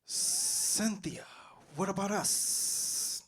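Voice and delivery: high, slow hissing snake. Acted. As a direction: stretch it out like a pleading snake. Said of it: "this is my impression of a snake".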